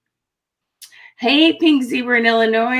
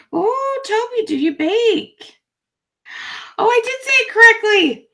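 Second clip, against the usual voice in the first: much higher, pleased and bright; delighted high voice; 14 semitones higher than usual. Real, not acted. Thrilled to have said a viewer's name right, high excited. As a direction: squeal with delight.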